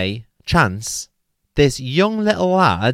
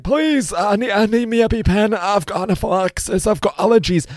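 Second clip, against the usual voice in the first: high, straining retold begging; desperate plea; 10 semitones higher than usual. Struggling to breathe, he begs the lad for his EpiPen, high and urgent. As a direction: plead for the EpiPen, voice pitched way up.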